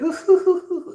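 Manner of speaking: high, loud hooting villain laugh; Joker. Retold or imitated. Streamer imitating the actor's famous laugh, hooting Joker laugh. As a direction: hoot out a theatrical villain's chuckle. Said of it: "that Joker laugh".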